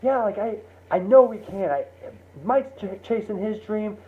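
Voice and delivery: high and eager throughout; fired up telling his wife; thrilled. Retold or imitated. John assuring his wife, thrilled.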